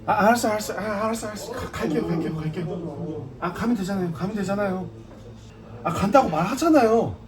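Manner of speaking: put-on belligerent drunk. 취한 채 억울해하며 물러남.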